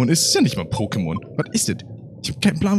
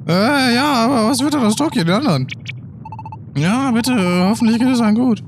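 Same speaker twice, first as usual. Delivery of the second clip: worried partner, a higher voice; anxious young partner voice; 8 semitones higher than usual. Acted. (besorgte Partnerstimme)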